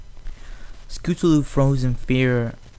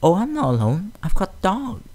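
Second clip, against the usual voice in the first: gentle pony voice; 8 semitones higher than usual. Acted. Fluttershy answers the fillies.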